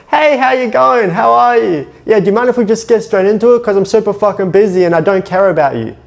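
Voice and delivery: high, fast, careless brush-off greeting; mock rushed dismissive opener. Sales coach mocking a rushed, dismissive call opener. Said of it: "you start the conversation of".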